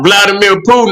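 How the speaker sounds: ordinary delivery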